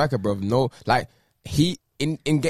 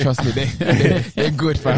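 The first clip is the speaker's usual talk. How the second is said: laughing reassurance; 5 dB louder, slower than usual. Acted.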